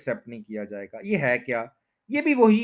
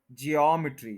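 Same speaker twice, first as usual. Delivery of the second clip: Geometry as jee-AWM-i-tree; second-syllable stress. Shown as a demonstration. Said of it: "second पे stress है".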